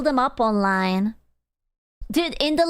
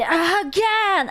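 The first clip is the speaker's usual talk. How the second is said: Bucky's battle cry; 8 semitones higher than usual. Retold or imitated. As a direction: bark out the hero's catchphrase, pitched up. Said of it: "a Bucky".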